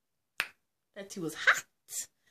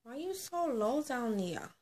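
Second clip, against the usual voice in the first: cooing; 6 semitones higher, 4 dB quieter, slower than usual. Real, not acted. Vlogger coaxing the camera angle, soft cooing. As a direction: coax it gently like to a little one. Coaxing her wig or pet-like talk.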